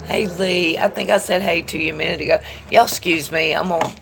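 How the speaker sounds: normal talking voice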